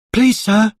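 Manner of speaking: put-on high pleading child; a small boy asking for his ball; little boy voice. A narrator's reading. Boy asking Mister Stingy for his ball, pleading. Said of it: "cried the boy".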